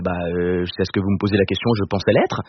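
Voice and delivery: low, hesitant comic answer; deflated hesitation. Retold or imitated. Comedian retells his doubtful answer to pollster, low and deflated. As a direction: drop low as if suddenly unsure.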